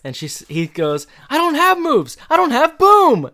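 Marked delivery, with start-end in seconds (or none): 1.3-3.3 very high, loud imitated protest; mock indignant squeal. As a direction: squawk it in hammed-up denial. Retold indignant denial when teased.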